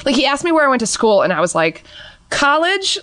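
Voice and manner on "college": College, as a high, lost question; unsure answer. Retold or imitated. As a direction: blank out and guess. Blanking at an interview question.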